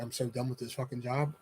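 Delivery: very low, quiet weary muttering; fed-up mutter. Retold or imitated. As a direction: mutter it under your breath, utterly done. Fed-up clerk after hang-up.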